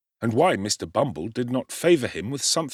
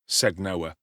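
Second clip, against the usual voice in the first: usual speaking voice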